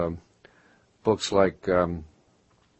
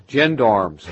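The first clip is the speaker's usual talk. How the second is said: louder, raised imitation of local speech; Baltimore accent; 4 semitones higher, 8 dB louder than usual. Offering another example of hometown talk, Baltimore accent. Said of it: "the way they talk down there".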